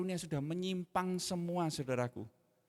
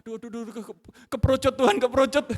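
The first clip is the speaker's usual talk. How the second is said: higher, louder, fast flustered stammer; panicked regret; 7 semitones higher, 9 dB louder, much faster than usual. Retold or imitated. Husband regretting he made his wife cry, panicked. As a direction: blurt it out in guilty panic. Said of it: "mukanya nggak enak".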